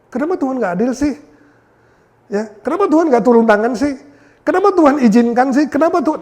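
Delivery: high, rising indignant whine; complaining at God. Retold or imitated. Believers blaming God for suffering, mock indignant. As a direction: demand answers from God in a hammed-up whine.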